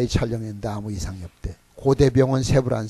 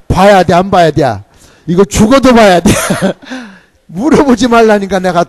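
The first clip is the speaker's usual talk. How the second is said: booming, high-pitched challenge to the crowd; loud preaching; 5 semitones higher, 12 dB louder than usual. Real, not acted. Preacher shouting a question to the congregation, loud. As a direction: rouse the congregation, full voice. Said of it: "preaching loudly to congregation".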